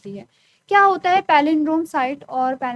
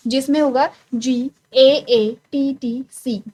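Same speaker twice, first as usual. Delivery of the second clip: slow spelling; 5 dB louder, much slower than usual. Shown as a demonstration. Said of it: "spells out the sequence".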